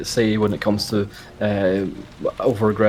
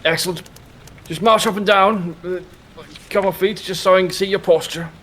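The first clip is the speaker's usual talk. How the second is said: put-on high, stiff old soldier; a proud veteran sizing up a recruit; old army man voice; 11 semitones higher than usual. Mr Muntz voice.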